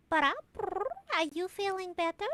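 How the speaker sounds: put-on cat-girl voice. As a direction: ask gently in the cat's voice. Storm the cat's character voice.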